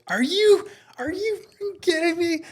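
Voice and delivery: very high-pitched mock yell; imitated yelling. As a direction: imitate an outraged man yelling from his car. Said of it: "I could hear him just yelling in his car".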